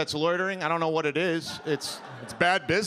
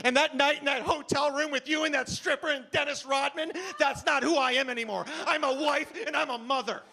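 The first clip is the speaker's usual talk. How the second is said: high-pitched indignant outburst; indignant woman; 7 semitones higher than usual. Acted. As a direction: protest as a respectable wife and mother. Acted woman disowns her past.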